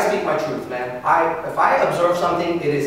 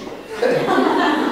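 laugh; 12 semitones higher than usual. Real, not acted. Laughs at own joke.

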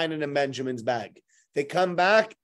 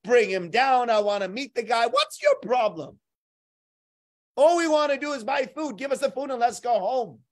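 angry outburst; 6 semitones higher, 4 dB louder than usual. Acted. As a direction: let the frustration boil over. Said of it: "He also says".

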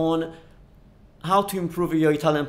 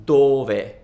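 "dove" with a long, loud first syllable and short ve; stress on DO; 3 semitones lower, 5 dB louder than usual. Shown as a demonstration. Teacher showing the first difference, stress on DO in "dove". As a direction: punch the DO in "dove". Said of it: "the stress is in the do".

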